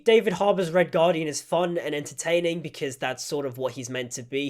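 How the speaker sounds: normal talking voice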